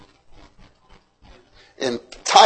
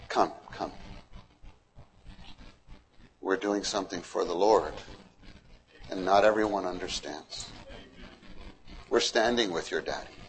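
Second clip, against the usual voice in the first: softer, lower calm reassurance; soothing mother; 6 semitones lower, 12 dB quieter than usual. Acted. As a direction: comfort the kids gently.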